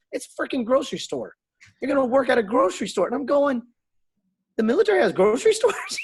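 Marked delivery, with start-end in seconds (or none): 0.1-3.0 much higher, fast and exasperated; blunt mock recruiter. Voices recruiter's blunt answer. 4.6-6.0 much higher and louder, laughing in disbelief. Retold or imitated. Retold disbelief.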